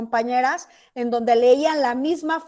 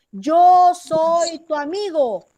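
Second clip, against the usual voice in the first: very slow, stretched-out words, louder and higher; slow, measured speech; 4 semitones higher, 6 dB louder, much slower than usual. Shown as a demonstration. Teacher imitating colonizers talking to natives, slow and deliberate. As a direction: speak slowly as if to someone who barely knows the language. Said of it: "les hablaban pausado".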